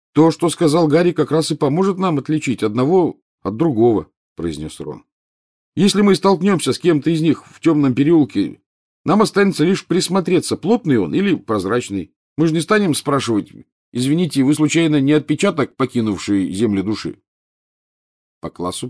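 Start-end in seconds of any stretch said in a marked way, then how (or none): 0.1-4.1 put-on higher, louder boy's voice; earnest schoolboy. Pupil backing up his friend in class, earnest. 5.8-17.2 put-on higher, louder boy's voice; earnest schoolboy. Pupil backing up his friend in class, earnest.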